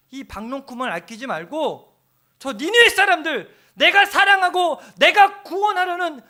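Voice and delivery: high, loud, urgent plea; God's pleading cry. Retold or imitated. God pleading with Jonah to care for Nineveh, high and loud. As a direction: cry out with a father's longing. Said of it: "하나님이 이렇게 물어보는 거야".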